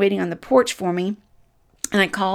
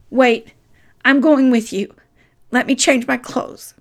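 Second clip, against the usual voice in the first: louder, calling out to stop him; urgent call; 4 semitones higher, 8 dB louder than usual. Retold or imitated. Child calls after dad taking the dog, urgent and loud. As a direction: hurry to catch dad before he leaves.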